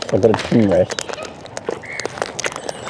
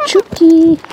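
high sing-song chook call; calling chickens; 6 dB louder than usual. Real, not acted. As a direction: coax the hens in a high voice.

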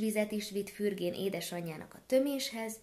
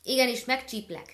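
loud, menacing gander threat; threatening hiss; 5 semitones higher, 7 dB louder than usual. Acted. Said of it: "sziszegte a gúnár".

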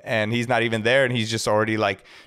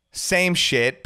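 normal talking voice

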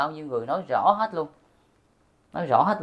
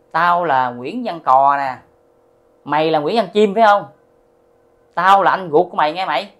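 loud, forceful self-announcement of a spirit; acted confrontation; 3 semitones higher, 11 dB louder than usual. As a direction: declare who you are, hammering each phrase. Possessing spirit confronts his father. (giả giọng vong quát lớn, dằn từng câu)

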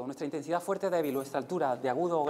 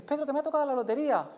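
much higher, fast and thrilled; excited demo; 10 semitones higher, 3 dB louder, faster than usual. Shown as a demonstration. Teacher showing the volunteer what to say: much higher, fast, thrilled. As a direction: blurt out the lottery win. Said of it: "vienes por aquí y me dices".

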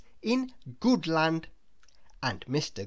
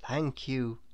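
quiet thanks; 5 semitones lower, 7 dB quieter than usual. A narrator's reading. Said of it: "said Mister Good".

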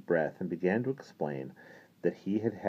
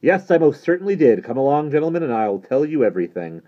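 loud, high, self-satisfied announcement; bubbling over with pride; triumphant glee; 8 semitones higher, 9 dB louder than usual. A narrator's reading. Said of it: "bubbling over with self-satisfaction and triumphant glee".

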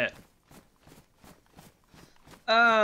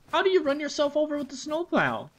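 incredulous; 12 semitones higher, 4 dB louder than usual. Real, not acted. Shocked at snowplow accident news.

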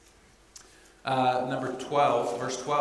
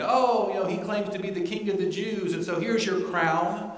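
higher-pitched mock taunt; 6 semitones higher, 3 dB louder than usual. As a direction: make fun of him in a raised, sing-out voice. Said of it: "They were mocking him".

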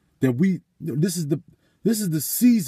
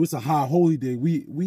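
normal talking voice

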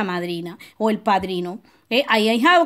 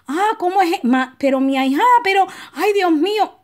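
much higher, gushing godmother caught off guard; surprised delight; 6 semitones higher than usual. Retold or imitated. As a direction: play the godmother overjoyed by a surprise gift. Madrina sorprendida por el envío. (sorpresa encantada, voz mucho más aguda)

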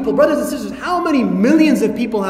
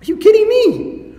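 1 stunned disbelief; 6 semitones higher than usual. Retold or imitated. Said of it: "you're like".

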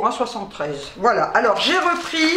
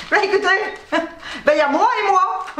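laughing; 6 semitones higher, faster than usual. Real, not acted. Joking about eating for two.

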